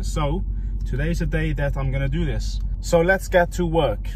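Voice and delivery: loud, high, energetic vlog opener; upbeat YouTube intro. Acted. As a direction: greet your viewers with big energy.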